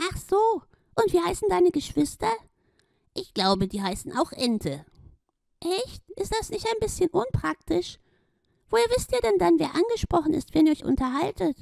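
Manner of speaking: very high, gushing glowing hippo voice; a tiny shining hippo overjoyed; delighted Gloria. Acted. Gloria welcomes Schlomi and his friend, very high and delighted.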